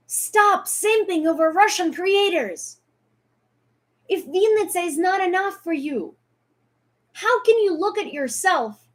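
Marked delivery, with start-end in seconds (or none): 0.1-7.4 angry scolding. Real, not acted. Angry appeal to followers.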